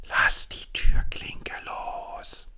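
slow, ghostly whisper from behind; eerie slow whisper; breathy whisper. A narrator's reading. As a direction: breathe the words slowly like a ghost. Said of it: "hauchte jemand hinter mir".

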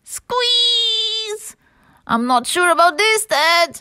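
high, squeezed, anxious character voice; high-pitched worried sock; nervous. Acted. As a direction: protest uneasily in a high voice. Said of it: "Simon was a little bit nervous".

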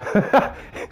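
high airy wheeze; breathless laugh. Real, not acted. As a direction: laugh off the failure. Laughs after dying.

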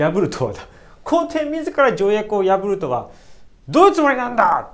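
loud, fast, high angry demand; furious rebuke. Retold or imitated. Ito scolding the emperor, loud and fast. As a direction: spit the question out in anger. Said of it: "ものすごい剣幕で".

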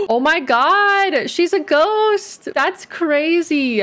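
high, slow, gasping amazement; drawn-out shocked awe at what happens; stunned disbelief. Real, not acted. Watching the sims turn ghost.

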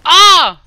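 loud, high shout of alarm; sudden gamer scream; startled yell. Real, not acted. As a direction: cry out as enemies appear. Startled by enemies appearing.

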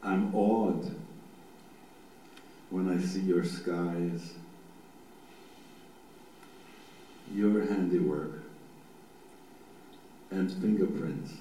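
low, meditative reading with long pauses; slow psalm reading. Shown as a demonstration. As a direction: read it slowly so each image can form. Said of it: "I'm gonna do it nice and slow".

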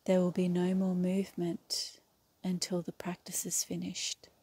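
calm yoga nidra guide with long, restful pauses; guided meditation. Real, not acted. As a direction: lead them into stillness, one unhurried phrase at a time. Leads a yoga nidra relaxation.

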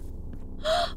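sharp high gasp; surprised gasp. Real, not acted. Surprised by fish lady.

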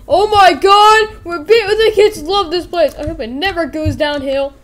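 high, loud excited outburst; overjoyed. Acted. Boss hearing they made three million dollars, overjoyed. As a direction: stretch the opening, thrilled and high.

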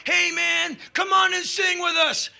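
hammed-up stage shout; calling out. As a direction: invite the crowd up. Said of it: "grabs the mic".

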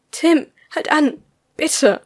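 high, loud, drawn-out plea; pleading shout. A narrator's reading. Stegi refuses to go on, begging Tim loudly to stop. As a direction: beg a runner to halt.

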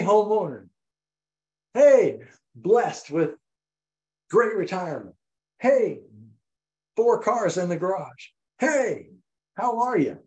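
high, often loud calls of "Hey"; hailing greeting. Acted. Servants greeting the newly renamed father of a multitude, high hailing calls. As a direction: play servants calling out hearty hellos.

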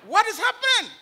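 high, loud cry of disbelief; shocked. Retold or imitated. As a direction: act out a startled, shocked question. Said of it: "and she was shocked".

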